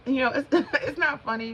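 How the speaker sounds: high-pitched talk through real laughter; laughing. Real, not acted. Laughing at her own gasping demo, amused and high. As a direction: laugh while insisting it's serious.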